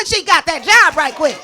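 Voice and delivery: loud, laughing victory; shouting laughing triumph at the punchline; triumphant. Real, not acted. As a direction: crow about winning it.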